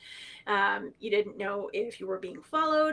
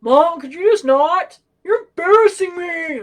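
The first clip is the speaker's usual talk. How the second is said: loud, high, drawn-out mock complaint; embarrassed son; 7 semitones higher, 13 dB louder than usual. Eldest son yelling to mom from the deli, imitated embarrassed holler. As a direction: holler it across a store, imitating a mortified kid. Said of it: "there's my eldest son".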